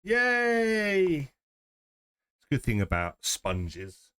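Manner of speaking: usual speaking voice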